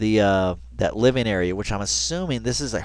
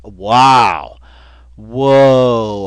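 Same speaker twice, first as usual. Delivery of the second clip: amazed; 7 dB louder than usual. Real, not acted. Amazed by the guest house.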